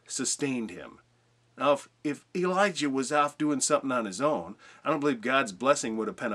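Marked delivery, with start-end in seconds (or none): none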